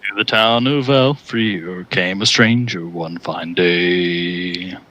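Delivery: low, slow baritone try. Shown as a demonstration. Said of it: "Can you do that one in baritone".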